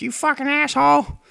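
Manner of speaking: teasingly parodied hero pouring out his feelings; Naruto, emotional. Retold or imitated. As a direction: squeak it out like an overwrought cartoon boy. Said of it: "and he was like".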